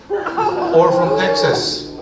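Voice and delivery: "or from Texas" jumps high and loud, stretched slow; mock-shocked high stretched tag line; high punchline.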